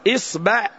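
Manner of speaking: إصبع said "is-ba'", i then a; short i, then open a in إصبع; kasra, then fatha. Shown as a demonstration. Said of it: "نكسر الهمزة فنقول".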